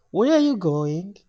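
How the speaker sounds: high, loud, slow question; drawn-out, high Spanish-accented clerk; Spanish lady official. Retold or imitated. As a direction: demand it slowly in the lady's high voice. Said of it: "another lady asks in a Spanish accent".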